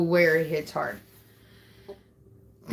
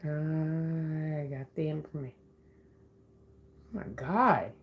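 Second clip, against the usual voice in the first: hushed; 7 dB quieter than usual. Real, not acted. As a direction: say it under your breath, almost to yourself. Quietly reacting to found information.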